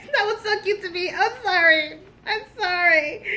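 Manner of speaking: high, laughing apology; laughing gush. Real, not acted. Reader apologising while giggling, high. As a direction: giggle through it.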